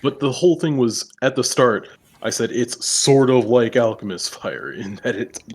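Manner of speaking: loud, heated insistence. Real, not acted. Heated argument over alchemist fire.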